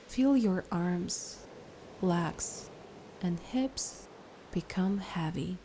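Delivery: unhurried, soothing instructions, easing off at phrase ends; guided meditation. Acted. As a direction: gently lead the listener into relaxation, letting each phrase settle.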